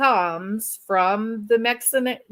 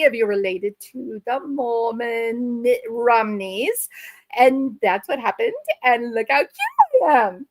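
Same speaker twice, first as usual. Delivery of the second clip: put-on Valley girl. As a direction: gush like a Valley girl about BYU. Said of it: "talking like a Valley girl".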